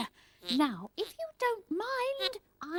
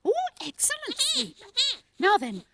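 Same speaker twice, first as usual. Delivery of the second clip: ordinary talk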